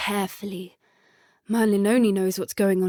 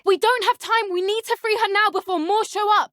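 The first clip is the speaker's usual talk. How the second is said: much higher, louder urgent plea; much higher urgent call; urgent shout; 9 semitones higher, 4 dB louder than usual. Acted. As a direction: hurry them along. Urgent rescue in combat.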